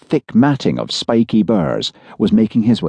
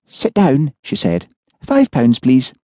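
put-on higher, matter-of-fact older woman; brisk, businesslike fortune-teller; 6 semitones higher than usual. A narrator's reading.

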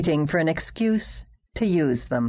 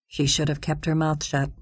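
usual speaking voice

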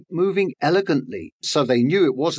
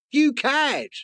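much higher, scornful bird voice; indignant bird; 11 semitones higher than usual. Acted. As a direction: sneer it like an offended bird. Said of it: "Secretary Bird thought".

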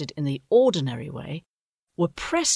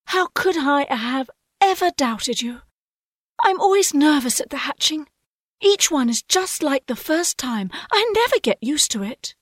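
high, fluttery nervous voice; jittery about the hatching; nervous mother owl; 11 semitones higher, 4 dB louder than usual. A narrator's reading.

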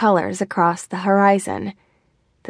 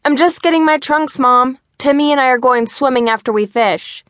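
put-on boy calls back high and loud; calling back; 6 semitones higher, 4 dB louder than usual. A narrator's reading. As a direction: shout the answer to mom through the house. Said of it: "Mikey called back".

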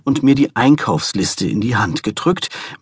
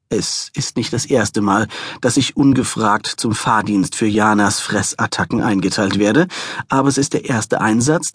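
ordinary delivery, nothing marked

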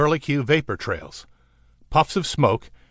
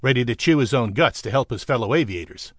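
plain reading voice

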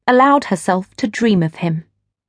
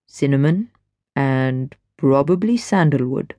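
lower, easy man's voice; smooth stranger; 5 semitones lower than usual. A narrator's reading. Stranger chats to Gwen at the rail, low and easy. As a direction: speak low and relaxed, at ease.

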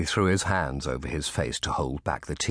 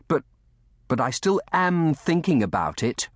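put-on high, halting, stubborn boy; retort; 9 semitones higher, 4 dB louder than usual. A narrator's reading. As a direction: answer back after giving in. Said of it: "retorted Colly".

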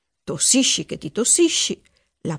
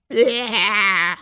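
princess's reaction; 4 dB louder than usual. A narrator's reading. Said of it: "disse la principessa Giulia".

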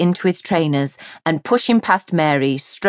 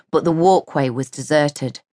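ordinary delivery

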